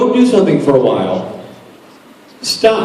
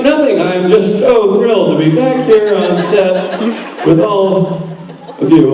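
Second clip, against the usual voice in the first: higher, slow overbright reply with laughs; forced nervous enthusiasm; 5 semitones higher than usual. Retold or imitated. Actor covering his nerves for the prop girl, higher, forced cheer. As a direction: insist you're fine, far too brightly. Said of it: "I'm like".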